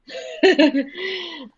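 high nervous laugh. Real, not acted. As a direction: laugh off the big question. Laughing at the big question.

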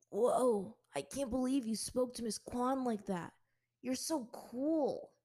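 lightly voiced boy, slow and amazed; awestruck boy. Classmate gushing at Azula, slow awestruck boy voice. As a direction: gush at her like a starstruck kid.

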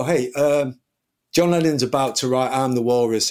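ordinary talk, nothing marked

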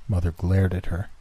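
ordinary delivery, nothing marked

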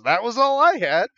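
high, loud mock despair; mock defeated. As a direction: whine that it was all. Ryan defeated, retold.

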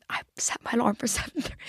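whispered with a laugh. Real, not acted. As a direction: whisper it like a guilty secret, giggling. Admitting early alarm, laughing.

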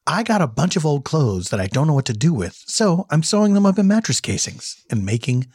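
low, hushed pitchman for a homemade business; deadpan commercial read. Acted. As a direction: sell it in a low local-ad voice. Plays mattress company commercial.

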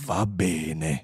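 low, resigned agreement; grave computer. Acted. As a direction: give in calmly, deep in the voice. Computer calmly gives in. (assenso grave e pacato)

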